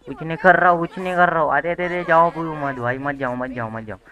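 high, loud, rapid pleading; frantic protest. Pretend. Player begging a game character not to go, frantic protest. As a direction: plead at the top of your voice, repeating yourself urgently.

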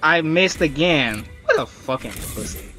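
usual speaking voice

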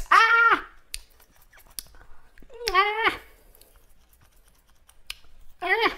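high loud sour-taste shrieks. Real, not acted. Sour spray shock.

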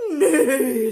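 loud moo through a laugh; giggly loud moo. Acted. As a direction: moo it and crack up. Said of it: "said the horse".

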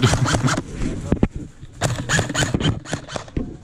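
high unvoiced giggle; laughs at himself. Real, not acted. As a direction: giggle at your own goof. Laughing at own story.

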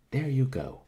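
sudden high, loud outburst; exclaiming. A narrator's reading. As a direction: burst out at her. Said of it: "he exclaimed".

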